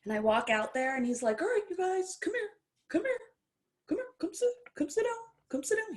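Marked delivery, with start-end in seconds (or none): 1.4-5.9 high, fast, eager coaxing voice pitched way up; squeaky excited old trainer calling people over; George's voice. Retold or imitated. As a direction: wave them over in a high sing-song. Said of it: "He talks like that too".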